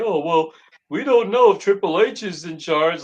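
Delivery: mocking defenders. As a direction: put on the voice of people making lame excuses. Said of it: "people will defend it ... and say".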